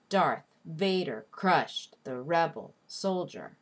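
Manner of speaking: slow, marking DARTH, VA-der, CRUSHED, REB-el, SOL-dier; exaggerated syllable stress. Shown as a demonstration. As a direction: beat out the stresses of "Darth Vader crushed the rebel soldier". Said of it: "exaggeratedly stress the stressed syllables".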